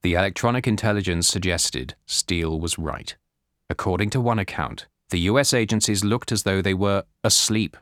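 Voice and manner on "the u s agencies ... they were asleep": ordinary delivery, nothing marked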